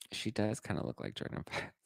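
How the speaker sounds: muttered repeat. Real, not acted. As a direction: repeat it under your breath.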